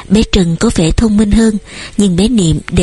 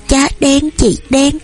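put-on high little toddler voice; toddler babble; 6 semitones higher, slower than usual. A narrator's reading. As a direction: prattle like a tiny child. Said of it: "nó bập bẹ".

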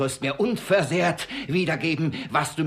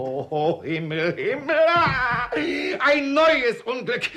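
high, loud wail; father wailing at news of the betrothal; despairing outcry; 9 semitones higher, 4 dB louder than usual. Acted. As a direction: cry out to heaven. Father discovers new calamity.

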